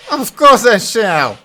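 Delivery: high, loud, slow proud fool; proud Butthead. Acted. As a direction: relish every word.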